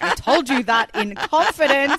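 loud, slow, outraged protest; half-joking betrayed yell; mock indignant shout. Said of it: "I was like".

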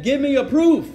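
high, loud argumentative comeback; indignant protest. Retold or imitated. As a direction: argue your case with rising heat. Argues against retaking his shahada.

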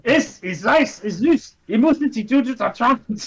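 joking mock-Austrian German. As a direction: put on playful pretend Austrian speech. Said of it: "I speak Austrian".

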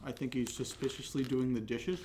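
talking while chewing chips; mouth full. Real, not acted. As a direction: say it around a mouthful. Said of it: "you're chewing away on your potato chips".

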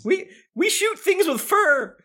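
high, slow mock hunter voice; hunters' guild member. Player mocking what hunters guilds are like, high drawn-out voice.

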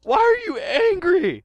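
high, loud and slow, almost wailing; Professor Sycamore voice. Acted. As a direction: whine the question out. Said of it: "Hi, I'm Professor Sycamore".

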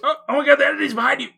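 high, loud hammed-up alarm; mock panic. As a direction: shout a warning in pretend panic.

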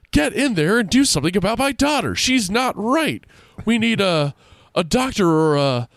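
mother screaming about her daughter; frantic screaming mother. Acted. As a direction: beg wildly for a doctor. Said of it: "Missus Paulson was screaming".

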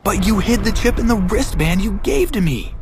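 high, strained outburst of disbelief; incredulous, pitch held high throughout; disbelieving yell. A narrator's reading. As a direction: protest in a high-pitched yell, without much volume. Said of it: "I yelled in disbelief".

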